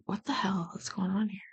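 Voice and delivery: soft whispered question; puzzled whisper. Real, not acted. Streamer reacting to a hearing clip, whispering in confusion. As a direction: whisper it, baffled.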